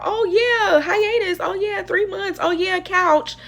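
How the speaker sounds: high, airy, dismissive singsong with a laugh; mocking idol voice. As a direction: toss it off like a carefree idol. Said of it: "they are talking about".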